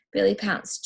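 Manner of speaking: ordinary delivery, nothing marked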